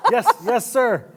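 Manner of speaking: high yes sir through laughter; laughing yes. Retold or imitated. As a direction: accept while laughing with joy. Accepting job offer overjoyed.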